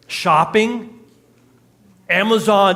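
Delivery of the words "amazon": normal talking voice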